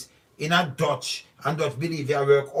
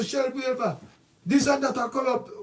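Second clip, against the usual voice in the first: high, mocking sing-song parody of the critics; 9 semitones higher than usual. Speaker parodies critics crying corruption, mocking high sing-song. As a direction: echo their cry to mock it. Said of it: "but them just run and say".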